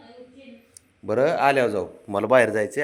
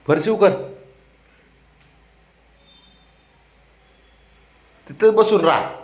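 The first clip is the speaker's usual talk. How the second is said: mock order; 8 semitones higher, 6 dB louder than usual. Real, not acted.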